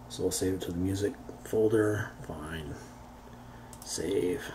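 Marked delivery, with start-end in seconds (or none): none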